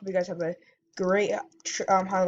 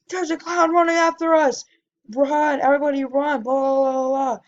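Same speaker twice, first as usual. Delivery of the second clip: louder, higher yelling of a warning; panicked yelling; 6 semitones higher, 5 dB louder than usual. Retold or imitated. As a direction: yell at the street to run, retelling the panic. Screaming while chased by clown.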